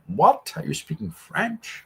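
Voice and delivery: high, baffled mock question; confused non-technical listener. A non-technical viewer baffled by jargon, mock bewildered. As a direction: play a lost beginner hearing jargon for the first time. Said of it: "you might be like".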